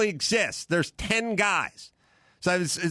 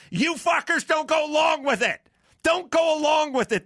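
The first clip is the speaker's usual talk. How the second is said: shouting; 7 semitones higher than usual. Real, not acted. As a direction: yell it straight at the listeners. Ranting podcast host shouts at audience.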